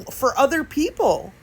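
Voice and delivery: ordinary talk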